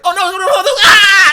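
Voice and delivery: high hammed-up screaming panic; mock cartoon scream. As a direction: scream like a bad cartoon actor. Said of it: "It's like".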